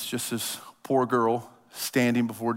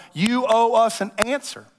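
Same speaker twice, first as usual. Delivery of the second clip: demanding taunt; 8 semitones higher, 3 dB louder than usual. Retold or imitated. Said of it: "egg him on and going".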